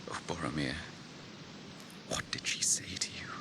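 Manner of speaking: grieving whisper. Acted. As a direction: breathe it to yourself, mourning a brother. Grieving brother murmurs to himself.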